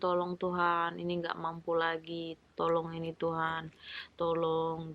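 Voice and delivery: soft, pleading prayer to God; hushed prayer. Acted. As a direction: plead quietly, as if alone with God. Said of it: "Tetap doa".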